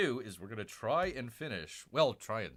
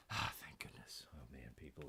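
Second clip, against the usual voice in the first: relieved whisper; 11 semitones lower, 13 dB quieter than usual. Real, not acted. Relief after setup finally works.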